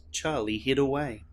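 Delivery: plain reading voice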